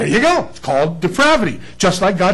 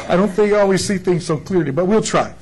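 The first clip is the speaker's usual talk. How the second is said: laughing. Real, not acted. Laughs at himself.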